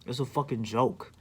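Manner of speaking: ordinary talk, nothing marked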